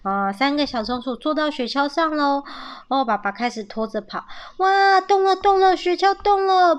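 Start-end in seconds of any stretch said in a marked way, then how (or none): 4.6-6.7 high, loud excited squeal of words; thrilled. A narrator's reading. Young squirrel seeing the sled start moving, thrilled. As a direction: exclaim in amazed delight, pitch way up.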